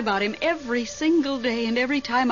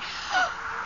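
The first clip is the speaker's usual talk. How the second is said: frightened gasp; 6 dB quieter than usual. Acted. Whistling wind scares her.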